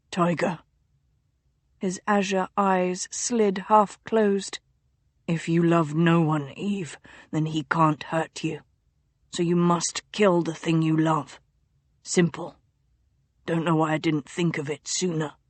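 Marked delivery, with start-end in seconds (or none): 0.1-0.6 low, soft secretive murmur; delirious duke whispering a childhood secret; feverish duke. A narrator's reading. Feverish duke's voice. 5.3-15.3 low, soft secretive murmur; delirious duke whispering a childhood secret; feverish duke. A narrator's reading. Feverish duke's voice.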